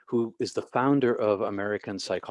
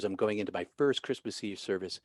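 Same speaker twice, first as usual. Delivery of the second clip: ordinary delivery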